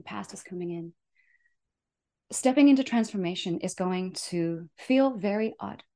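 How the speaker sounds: ordinary delivery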